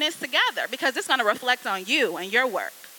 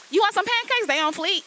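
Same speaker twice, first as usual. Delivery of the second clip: high, bright mock waitress voice; a playful imitation of a waitress pushing slang; 8 semitones higher than usual. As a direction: pitch way up like a chirpy waitress. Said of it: "none of the waitresses in there be like".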